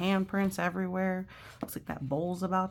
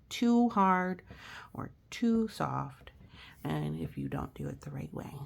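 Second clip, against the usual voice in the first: ordinary delivery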